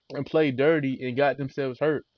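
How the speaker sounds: ordinary talk, nothing marked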